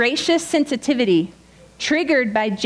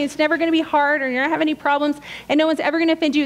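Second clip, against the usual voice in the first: rushed mock list; 4 semitones higher, much faster than usual.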